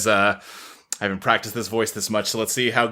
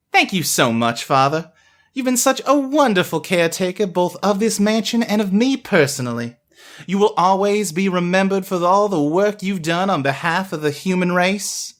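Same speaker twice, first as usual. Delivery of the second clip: lightly voiced gracious woman; new head butler; 8 semitones higher than usual. Joanna thanks her father before the guests, lightly voiced and gracious. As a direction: thank him warmly and formally.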